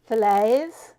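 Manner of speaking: "Fillets" said with a hard t at the end; Ricky's fillets. Shown as a demonstration. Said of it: "he calls them fillets".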